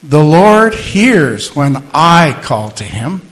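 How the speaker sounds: slow, loud, weight on "I"; stress on I. Shown as a demonstration. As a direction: say it again, landing on "I". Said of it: "Say it some more".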